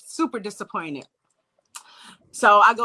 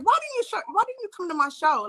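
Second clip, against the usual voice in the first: playful complaint; 8 semitones higher, faster than usual. Retold or imitated. Acted playful complaint to Prince.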